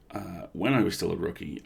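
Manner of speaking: plain reading voice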